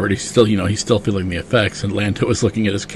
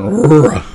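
mock Wookiee roar, higher and louder; Wookiee growl; 6 semitones higher, 7 dB louder than usual. Host voicing Chewie pinned in a fight, a loud mock growl. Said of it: "and Chewie going".

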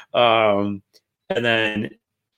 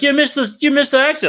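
high-pitched urgent alert; mock exasperated passenger; nagging girlfriend impression; 4 dB louder than usual. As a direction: imitate a partner calling out the mistake. Voices girlfriend pointing out missed exit.

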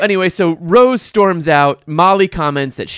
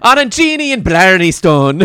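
loud, higher mock Irish accent; 4 semitones higher, 7 dB louder than usual. Podcaster naming the two flirting men, mock Irish accent. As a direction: ham up a comic Irish accent. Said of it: "say that with an Irish accent".

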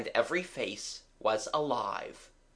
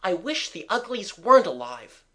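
urgent cried wish; 5 semitones higher, 5 dB louder than usual. A narrator's reading.

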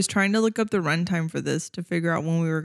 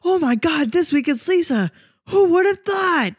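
high-pitched fake astonishment with a gasp; mock surprise; 9 semitones higher than usual. Guest imitating the host acting surprised it's her, hammed-up mock shock. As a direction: gasp and overact the big surprise. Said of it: "you act like it's a big surprise".

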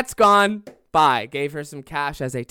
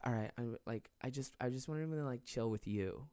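imitated soft coaxing; 3 semitones lower, 14 dB quieter than usual. Stripper coaxing him once door closes.

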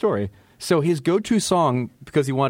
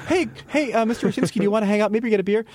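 higher, shy eager voice; eager rookie; 9 semitones higher, faster than usual. Retold or imitated. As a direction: ask timidly like a newcomer. Voicing a rookie asking out.

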